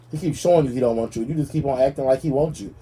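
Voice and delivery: ordinary delivery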